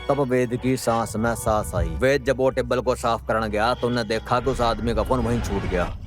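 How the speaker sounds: ordinary talk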